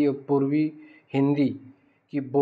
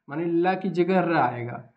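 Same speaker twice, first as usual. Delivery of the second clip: ordinary delivery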